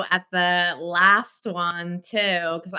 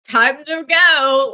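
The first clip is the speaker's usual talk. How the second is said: slow, loud and higher, dragging out the words; mock announcement; 7 semitones higher, 9 dB louder, much slower than usual. Friend retelling the bandmate's exit, loud slow mock. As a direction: announce the exit like a big joke. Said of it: "Dennis was like".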